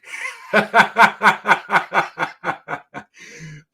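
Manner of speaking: big loud burst of laughter. Real, not acted. Reading a viewer's comment, bursting out laughing. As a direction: crack up loudly.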